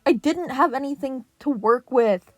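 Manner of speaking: loud defensive shout; defensive yell. A narrator's reading. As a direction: defend yourself loudly. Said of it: "Splinter yelled".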